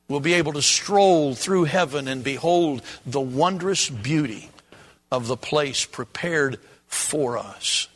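ordinary talk

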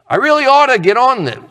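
high, quick self-reproach; acted-out good intention; mock resolve. Voicing a procrastinator's resolve.

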